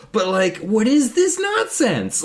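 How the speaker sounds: high, drawn-out mock outrage; exasperated, high-pitched protest; indignant. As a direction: stretch it in exasperation. Acting out indignation at the woman.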